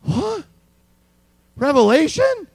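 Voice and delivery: high mock alarm on What, loud on Revelation; scared surprise. Preacher mimics a scared churchgoer, high alarmed. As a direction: gasp it like a frightened churchgoer. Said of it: "this scared look in their eyes".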